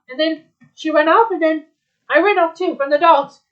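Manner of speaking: ordinary delivery, nothing marked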